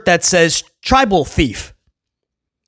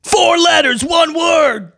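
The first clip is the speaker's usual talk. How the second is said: exasperated; 8 semitones higher, slower than usual. Real, not acted. Exasperated loud outburst.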